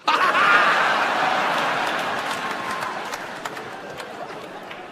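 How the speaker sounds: high unvoiced laugh at his own joke; breathy burst of laughter. Real, not acted. Comic laughing at his own impression. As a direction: crack up at the impression.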